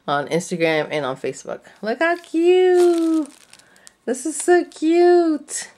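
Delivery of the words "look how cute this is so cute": high, slow, louder coo; gushing. Real, not acted. Crafter showing viewers a cute bow, high slow coo. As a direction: coo over how cute it is.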